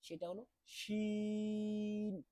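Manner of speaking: শিন with a long sh hiss; Arabic letter shin. Shown as a demonstration. Teacher introducing শিন to students. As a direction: stretch the sh of শিন. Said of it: "পরবর্তী হরফটা হলো".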